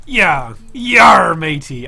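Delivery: loud hammed-up pirate shout. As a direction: bellow it like a cartoon pirate. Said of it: "It's no longer Talk Like a Pirate Day".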